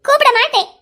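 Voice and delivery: high, loud flat refusal; angry refusal. Acted. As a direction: spit out the refusal. Wife refusing husband. (கோபமாக மறுத்துக் கத்துதல்)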